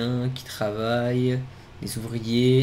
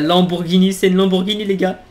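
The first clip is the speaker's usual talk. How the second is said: loud, thrilled announcement; real amazement; excited; 6 semitones higher, 9 dB louder than usual. Real, not acted. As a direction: show off the car to viewers. Discovers the boss's supercar.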